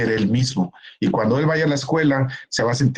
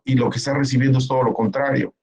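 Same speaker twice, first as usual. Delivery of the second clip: ordinary talk, nothing marked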